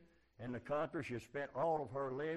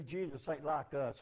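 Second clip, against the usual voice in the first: normal talking voice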